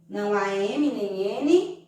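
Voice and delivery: usual speaking voice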